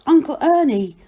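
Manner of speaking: high, loud, joyful call; shouting. A narrator's reading. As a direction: hail a relative from afar. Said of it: "he shouts".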